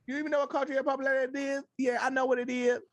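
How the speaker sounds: high-pitched, hurried mock complaint; high, rapid mimicry of an aggrieved influencer; imitating young woman online. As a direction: whine defensively in a high put-on voice. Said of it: "her whole I G was like".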